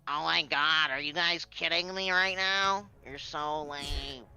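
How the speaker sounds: whiny nasal gamer voice; nasal complaining teammate; nasally voice. Retold or imitated. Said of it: "has the nasally voice".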